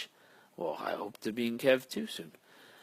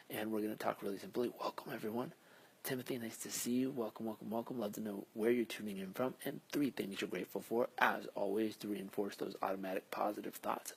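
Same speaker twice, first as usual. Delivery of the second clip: soft hushed talking; quiet at night; 6 dB quieter than usual. Real, not acted. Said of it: "so I'm gonna whisper".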